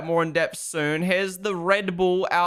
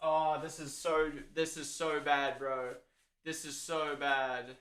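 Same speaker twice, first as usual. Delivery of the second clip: soft, slow, dejected groaning; slow, defeated complaint; dismayed; 9 dB quieter, slower than usual. Real, not acted. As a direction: say it quietly, sinking into dismay. Dejected over the spill.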